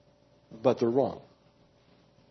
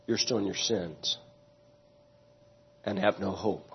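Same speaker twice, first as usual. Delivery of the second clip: much softer, slow, grave; solemn hushed warning; 6 semitones lower, 7 dB quieter than usual. Acted. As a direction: let the words sink in quietly. Solemn conclusion.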